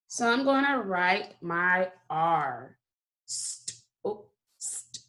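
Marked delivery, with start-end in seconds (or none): 3.3-5.0 "St-" as bare voiceless s and t sounds; soft, unvoiced "st-", hiss then tap; sounding out. Shown as a demonstration. Teacher sounding out the word start, slowly and softly. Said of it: "saying it slowly each".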